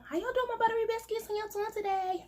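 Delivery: high, fast, sugary greeting; high playful hello to the buttery biscuits; sing-song greeting. Acted. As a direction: greet the fans in a squeaky high voice.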